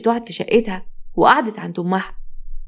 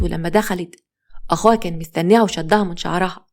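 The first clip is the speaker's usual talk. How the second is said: plain reading voice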